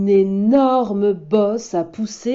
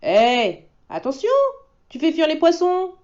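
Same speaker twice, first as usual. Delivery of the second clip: put-on higher, calling boy's voice; boy shouting; 9 semitones higher than usual. Fishing boy shouts at the wolf, higher. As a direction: call out across the stream. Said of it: "crie un petit garçon".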